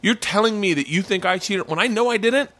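re-enacted wounded disbelief; mock indignation. Re-enacts indignation at girlfriend's accusation.

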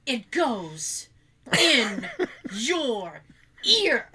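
"It goes... in... your... ear", a gap between words; "in", "your", "ear" each set apart by a pause, as if explaining to a dimwit; exaggerated pauses. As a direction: spell out "in your ear" one word at a time, "ear" punched. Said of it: "With the pauses".

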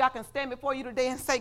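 ordinary talk

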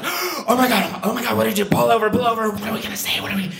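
high, urgent mock panic; gasp, then fret in a high, hurried stage panic; retold frantic conferring; panicked hushed exchange. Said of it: "you whisper to each other".

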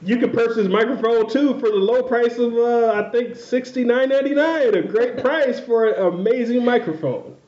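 playful salesman voice; mock ad read. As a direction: sell it like a hammed-up commercial. Improvised mock sponsor read.

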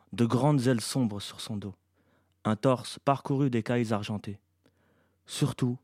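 usual speaking voice

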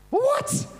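high, loud yelp of alarm; panicked. Retold or imitated. Preacher waking to a blank mind, panicked. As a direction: shoot way up in pitch, mock panic. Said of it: "Panic, panic ensued".

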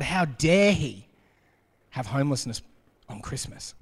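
mock the self-righteous complaint; mock indignation. Mocking humans yelling at God.

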